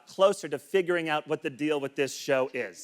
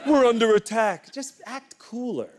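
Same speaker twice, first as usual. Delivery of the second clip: louder mock cry of alarm; mock needy; 4 semitones higher, 3 dB louder than usual. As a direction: overplay a needy plea for support. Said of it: "you're so needy".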